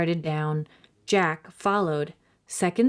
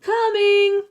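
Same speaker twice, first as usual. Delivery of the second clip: high, loud shout back; 6 dB louder than usual. A narrator's reading. Annie shouts down to mother, loud. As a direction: yell it out the window.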